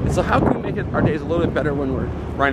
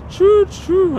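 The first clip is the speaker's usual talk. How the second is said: high train whistle imitation; 5 dB louder than usual. Train noise joke.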